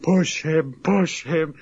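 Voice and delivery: half-hearted jeering chant; mild mock yell from onlookers; mock crowd scream. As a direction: imitate a crowd yelling, held back. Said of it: "people screaming and just people going like".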